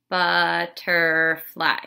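"Butterfly" drawn out slowly, syllables stretched; syllable clap. Shown as a demonstration. Teacher modelling syllables by stretching the word slowly. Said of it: "just say it".

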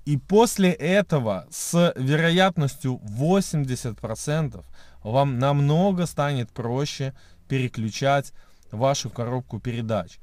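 ordinary talk, nothing marked

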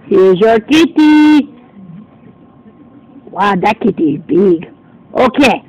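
normal talking voice

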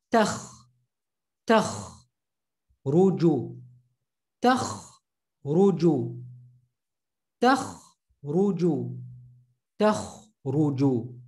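"takh" as one syllable ending in a rasping kh; syllable drill. Shown as a demonstration. Teacher drills the syllable for students to repeat, rasping kh. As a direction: clip "takh" short and scrape the kh. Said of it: "as one syllable".

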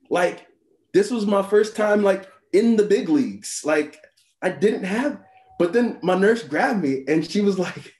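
normal talking voice

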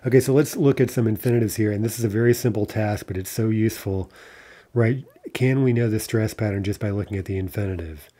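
ordinary talk

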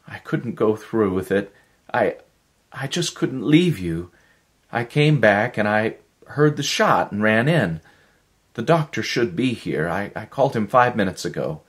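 tumbling out worried explanations to Miller; lightly voiced anxious woman. A narrator's reading. Helen's voice held in story.

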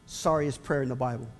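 ordinary delivery

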